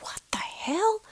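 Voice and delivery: very high, whispered shock. Retold or imitated. Hearing crazy steps, high whispered shock. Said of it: "I'm like".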